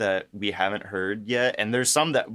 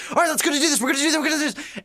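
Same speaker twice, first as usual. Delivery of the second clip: much higher, rushed hype voice; pumped-up Jack; 4 dB louder, much faster than usual. Retold or imitated. Jack bursting out after the elixir, high and rushed. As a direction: fire yourself up like a hyped hero. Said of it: "came out fucking going".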